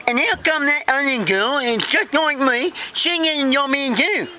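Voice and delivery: high, garbled complaint; indignant Vicky. Acted. Vicky questions boss about the other girl, high garbled protest. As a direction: protest in a mangled put-on voice.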